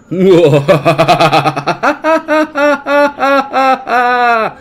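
loud, high hooting belly laugh. Acted. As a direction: hoot with laughter like a big bear. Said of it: "hooted Baloo".

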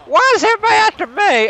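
high, rushed complaint; fast panicked whine. Real, not acted. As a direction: blurt it out in mock panic. Player mock complains in panic.

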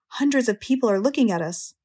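ordinary delivery, nothing marked

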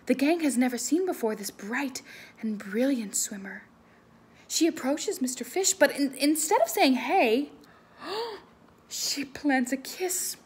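lively, loud read-aloud voice; animated picture-book reading; story reading to children. Acted. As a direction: read it big for the kids.

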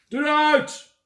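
high loud command; commanding shout. Acted. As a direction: bark the magic word at the box. Milkman commands box. (de melkboer roept luid tegen het doosje)

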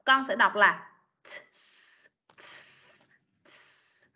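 sounds split apart, the S's breathed out; t then s. Shown as a demonstration. Teacher breaks "shorts" into sounds for a student. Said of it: "âm T trước rồi mới âm sờ sau".

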